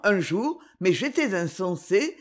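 usual speaking voice

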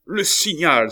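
excited cry. A narrator's reading. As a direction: cry out as if the signal has come. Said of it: "s'écria Maurevel". (s'écrier, fort et aigu)

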